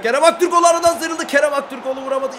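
fast, high and loud play-by-play; excited commentary. Real, not acted. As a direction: call the breakaway at full pace. Hızlı atak anlatımı. (heyecanlı hızlı anlatım)

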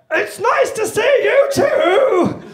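put-on very high, slow, loud baby voice. To the ex-girlfriend, a shrill baby voice. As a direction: squeak it like a toddler. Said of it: "still haven't quite nailed those baby voices".

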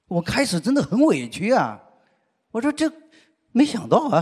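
ordinary delivery

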